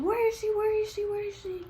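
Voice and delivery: high, insistent mock scream. Girl making a scene in the office, mock screaming. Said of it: "She was screaming".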